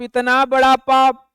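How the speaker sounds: ordinary delivery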